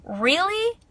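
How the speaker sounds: high, disbelieving "Really?"; incredulous. Real, not acted. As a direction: throw it back in disbelief. Incredulous reply to commenters.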